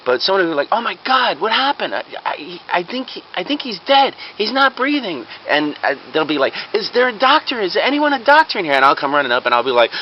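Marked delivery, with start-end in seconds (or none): 0.7-5.3 high-pitched, alarmed bystander; mock panic. Bystander finding a man collapsed on the floor, mock panic. 6.7-8.7 high, mock-urgent plea; urgent call for help. Bystanders seeking a doctor for a collapsed man, mock urgent.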